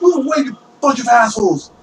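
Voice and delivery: high, snarling order to get out of the way; bully the crowd aside; angry thief. Acted. Thief shoves through the townspeople, high angry snarl.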